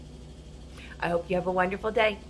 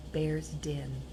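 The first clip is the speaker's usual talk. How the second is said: soft unvoiced whisper; hushed whispered ending; 7 semitones lower, 8 dB quieter than usual. A narrator's reading. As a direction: whisper it so the bear won't wake. Sneaking into sleeping bear's den.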